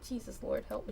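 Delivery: normal talking voice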